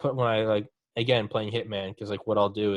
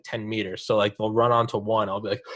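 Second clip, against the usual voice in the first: ordinary talk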